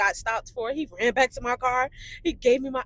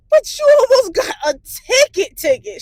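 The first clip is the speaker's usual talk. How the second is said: imitating an exasperated mother, high and loud; shrieked retold telling-off; mock scolding; 11 semitones higher, 10 dB louder than usual. Mother scolding daughter over a near ticket, high loud scolding.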